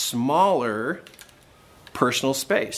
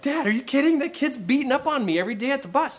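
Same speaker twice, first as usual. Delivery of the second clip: high, fast protest; imitating a boy's high-pitched objection; incredulous son; 10 semitones higher, faster than usual. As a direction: protest like a kid who can't believe it. Son protesting to dad, retold.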